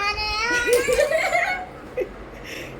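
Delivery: high laugh breaking into a cry; laughing hard at the prank. Real, not acted. Laughing at the boy crying over the prank, high laugh.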